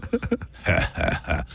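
creepy laugh. Shown as a demonstration. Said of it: "a little creepy for some".

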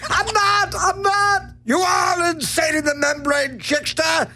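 very high squeaky puppet voice, fast and frantic; frantic Chickster. Acted. The Chickster character raving he's insane. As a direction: shriek it like a crazed cartoon chick.

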